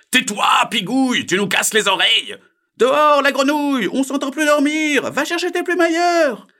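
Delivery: high, loud, furious shouting; angry uproar. A narrator's reading. Pond dwellers silence singing frog, loud high shouts. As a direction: yell like sleepless neighbours in an uproar. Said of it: "ce fut un vrai chahut".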